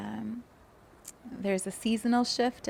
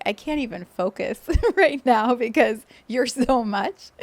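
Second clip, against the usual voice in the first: flustered laugh; 3 semitones higher, 8 dB louder than usual. Retold or imitated. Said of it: "total distracted look".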